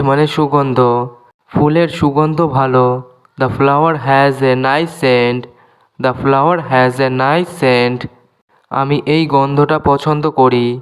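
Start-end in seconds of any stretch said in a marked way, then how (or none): 3.4-8.0 slow, clear English for learners; careful dictation pace; slow teaching demo. Shown as a demonstration. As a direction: spell each word out slowly for students. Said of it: "এখানে S silent".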